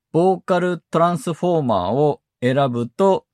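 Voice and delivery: usual speaking voice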